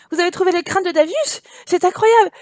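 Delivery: high, loud amazed reading; hammed-up gasp of wonder; mock astonishment. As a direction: overplay the disbelief. Reads astonished NPC line.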